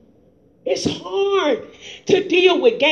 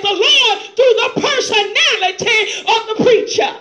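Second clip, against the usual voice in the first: impassioned sermon delivery, laughing through it; fiery preaching; 3 semitones higher than usual. Real, not acted. Pastor exhorts his church in full-force preaching. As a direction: preach it hard with rising fervour.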